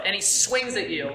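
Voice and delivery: ordinary talk, nothing marked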